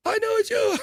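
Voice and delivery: very high squeaky falsetto; high-pitched voice. Retold or imitated. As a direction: squeak it like a cartoon. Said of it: "pretends to be high pitched".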